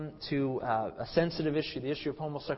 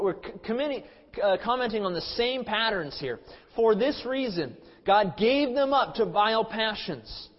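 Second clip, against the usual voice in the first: higher, louder declaiming of the verses; emphatic Bible reading, slowing on "vile passions"; forceful scripture reading; 5 semitones higher, 5 dB louder than usual. Acted. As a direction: proclaim the passage from the pulpit. Preacher reads scripture with force.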